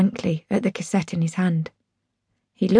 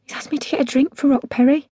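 higher-pitched, earnest message; urgent; 6 semitones higher than usual. A narrator's reading. Daughter carrying dad's message to mum, urgent. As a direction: relay it as if it really matters. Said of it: "with all the urgency".

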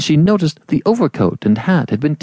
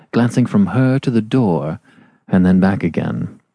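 plain reading voice

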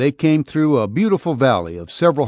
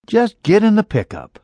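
put-on much higher curt young woman; young woman to cowboy, curt higher order; her voice; 9 semitones higher than usual. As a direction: order him in, short and high.

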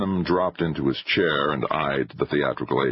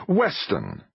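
exasperated exclamation; 5 semitones higher than usual. A narrator's reading. Fist crashes on the table.

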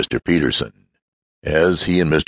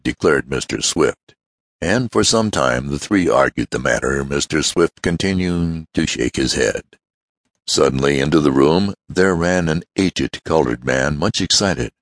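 plain reading voice

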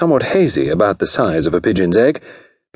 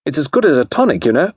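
high, quick society chatter; high, silly socialite voice; giggly lady; 7 semitones higher, faster than usual. A narrator's reading. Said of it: "And she giggled also".

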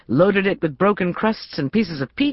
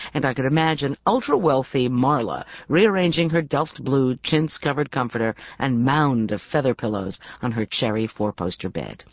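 plain reading voice

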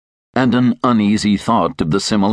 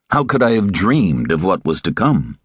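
plain reading voice

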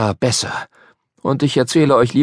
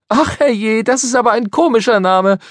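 put-on high, louder girl's exclamation; exclaimed; 13 semitones higher, 4 dB louder than usual. A narrator's reading. Polly cries out at the boy's odd name, high and louder. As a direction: tease him, amused and loud. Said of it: "rief Polly".

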